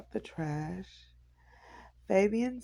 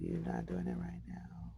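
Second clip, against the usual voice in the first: soft unvoiced whisper; 9 dB quieter than usual. Real, not acted. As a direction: whisper it under your breath. Aside to someone nearby.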